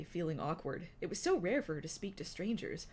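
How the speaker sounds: ordinary delivery, nothing marked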